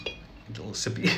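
breathy whisper aside to self. Real, not acted. To himself while drinking on camera, a whispered aside. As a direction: murmur it under your breath mid-sip.

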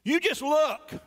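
high indignant jab; angry son. Acted. Elder son scolding his father, angry and high. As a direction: snap it at the father. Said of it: "He's angry".

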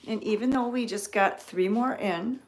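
normal talking voice

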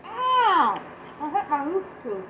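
talking with mouth full; chewing candy. Real, not acted. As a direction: hand over mouth. Seen 2: child's mouth works on food, then hand covers mouth.

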